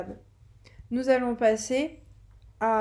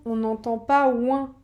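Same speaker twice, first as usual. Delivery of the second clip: ordinary talk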